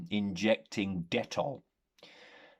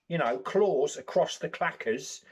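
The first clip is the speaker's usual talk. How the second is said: ordinary delivery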